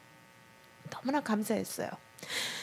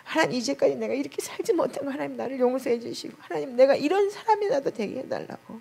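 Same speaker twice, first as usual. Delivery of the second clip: higher, softer pleading prayer, retold through tears; tearful prayer; 6 semitones higher, 3 dB quieter than usual. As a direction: plead with God as if weeping again. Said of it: "눈물로 통곡을 하면서". (울먹이며 높게 드리는 간절한 기도)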